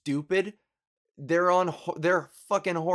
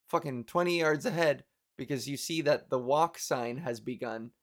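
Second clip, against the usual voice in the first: ordinary talk, nothing marked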